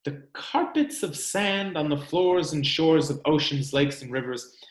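expressive storybook read-aloud; reading to children. Acted. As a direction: read it like story time for little ones. Reads picture book to children.

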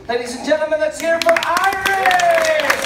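loud showman call to the crowd; rally the audience; announcer. Acted. Host calls applause for Iris.

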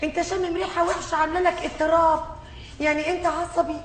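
normal talking voice

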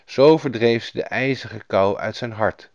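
usual speaking voice

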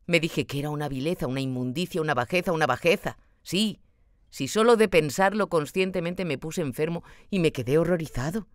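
usual speaking voice

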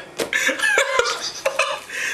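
high, wheezy laugh; helpless giggling. Real, not acted. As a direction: crack up at your own bit. Cracking up at own bit.

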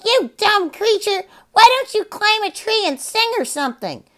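very high squawky shout; parrot shout. Acted. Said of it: "shouted just as loudly".

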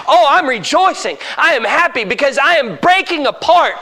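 high, loud preacher playing a gloating devil; rejoicing Satan. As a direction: boast triumphantly, building in force. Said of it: "Satan's doing a happy dance".

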